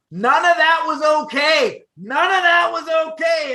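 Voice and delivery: high, loud repeated protest; mock outcry. To cohost, imitating the victims, a loud high mock outcry. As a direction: shout it for the victims, half in jest.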